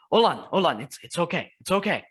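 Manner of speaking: urgent comfort. Acted. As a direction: rush to soothe him. Comforting crying friend.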